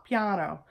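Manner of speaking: piano with a high open ah; the bright Italian piano. Shown as a demonstration. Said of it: "It has a high ah".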